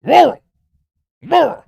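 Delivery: high, loud yelping barks of the word; barking dog. Acted. Streamer mocking rural voters as barking dogs. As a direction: bark "Rural" like a yapping dog. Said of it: "That's their fucking bark".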